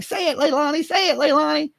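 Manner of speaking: mock prodding. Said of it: "Billy Joe would be like".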